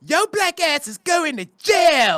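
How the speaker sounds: high, loud, slow Pittsburgh yell; furious Pittsburgh girlfriend on the phone; Pittsburgh accent. Retold or imitated. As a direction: shout it like the Pittsburgh girlfriend, drawn out. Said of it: "That's how she said it".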